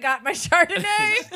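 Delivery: a hammed-up wine mom; mock tipsy Hermione. Mocking Hermione with her wine, slow mock drawl.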